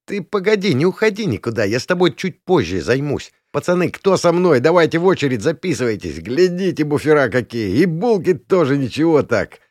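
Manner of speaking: high, leering bully voice; cocky high-voiced taunting; mocking gang leader. A narrator's reading. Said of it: "насмешливо бросил царёк".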